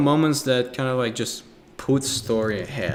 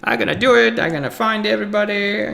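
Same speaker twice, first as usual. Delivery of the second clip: much higher and louder, eager go-getter pitch; enthusiastic salesman; 10 semitones higher, 5 dB louder than usual. Retold or imitated. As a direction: sell it like an overeager salesman who'll find everybody. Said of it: "the enthusiastic salesman".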